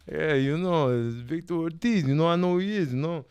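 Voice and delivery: imitation of the Rocky star; casual Rocky-style impression; Sylvester Stallone. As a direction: do the famous action star's voice. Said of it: "Make sure you do his voice".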